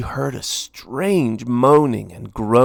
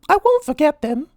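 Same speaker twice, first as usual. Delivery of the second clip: high, louder mouse voice pleading; Minnie pleading; 5 dB louder than usual. A narrator's reading. Minnie begging Lord Gurr, high pleading mouse voice. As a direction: beg sweetly for food.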